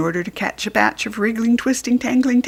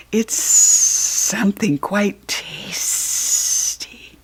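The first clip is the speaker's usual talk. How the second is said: very slow, breathy hissing promise; sly snake reassurance; much slower than usual. Acted. Snake reassuring the puppy, very slow and hissing. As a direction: savour every word like a hungry snake. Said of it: "Sylvester assured him".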